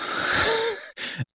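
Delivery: a quick high rueful laugh; short laugh. Real, not acted. Recalling the bullying to the host, a brief laugh. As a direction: laugh it off, a little bitter.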